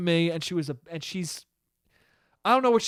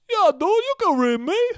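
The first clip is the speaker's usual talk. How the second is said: higher, louder dopey dad voice; a dim, friendly dad; dumb dad voice; 13 semitones higher, 6 dB louder than usual. Retold or imitated. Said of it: "that voice".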